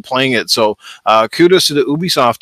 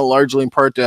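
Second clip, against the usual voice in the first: ordinary talk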